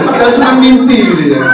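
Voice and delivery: ordinary delivery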